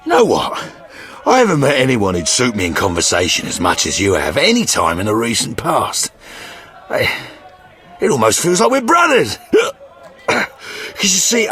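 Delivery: put-on drunken swoops in pitch; merrily drunk. Sergeant bonding with witcher after drinks, merrily drunk. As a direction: gush like a tipsy new best mate.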